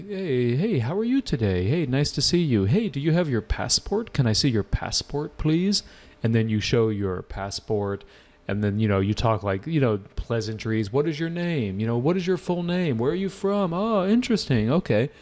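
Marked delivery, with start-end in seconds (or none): 0.0-5.8 bright, higher friendly examiner greeting. Retold or imitated. Teacher imitating the friendly examiner greeting a candidate. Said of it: "they'll say". 10.9-15.1 higher, chirpy examiner small talk. Retold or imitated. Teacher imitating the examiner's pleasantries with a student. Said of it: "And then they say".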